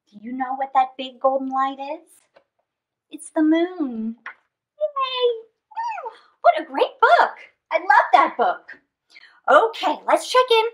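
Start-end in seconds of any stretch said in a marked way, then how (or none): none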